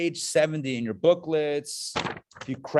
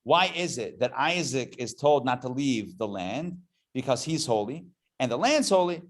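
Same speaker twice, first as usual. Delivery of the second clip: ordinary talk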